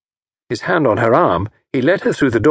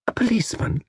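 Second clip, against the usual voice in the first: uneasy; 12 semitones higher, 5 dB quieter than usual. Acted. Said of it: "She shivered a little".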